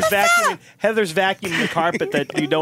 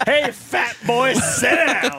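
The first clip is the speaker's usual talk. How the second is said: mock shout; 5 semitones higher, much slower than usual. Yelling at hired Santa.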